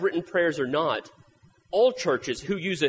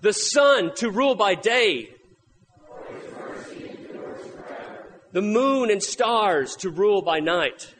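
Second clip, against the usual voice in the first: scripture reading; 4 semitones higher than usual. Acted. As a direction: read it like scripture from the pulpit. Reads Psalm 136 aloud.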